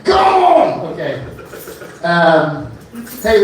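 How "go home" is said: mock heckler shout. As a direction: yell it back at the heckler.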